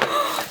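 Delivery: sharp high gasp of delight; excited gasp. Real, not acted. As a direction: gasp at the foils. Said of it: "I'm gonna be gasping".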